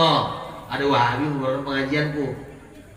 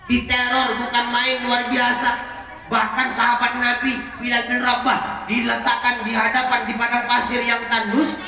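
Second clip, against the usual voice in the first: impassioned preaching; 7 semitones higher than usual. Acted. Preacher recounting persecution, raised and intense. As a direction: build the list with intensity.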